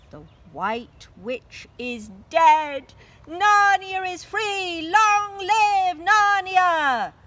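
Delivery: loud, high, slow cheering; victory cries. Acted. Narnians cheer the witch's death, loud and high. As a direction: shout it out like a crowd in triumph. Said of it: "The cries went up".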